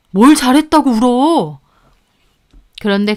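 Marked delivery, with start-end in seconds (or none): 0.1-1.6 higher, louder rebuke; angry scolding. A narrator's reading. Family elder to the crying daughter-in-law, angry scolding.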